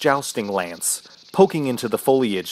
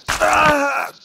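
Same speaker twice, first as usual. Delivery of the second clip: high, sharp agonised scream; scream of pain; 5 dB louder than usual. Acted. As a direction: cry out as the pain hits. Sudden agony in the leg.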